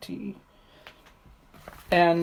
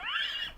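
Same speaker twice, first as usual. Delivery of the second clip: much higher shrieking laugh; startled high laugh; 13 dB quieter than usual. Real, not acted. Laughing at her own slip on camera, much higher shriek. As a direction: burst out laughing at yourself.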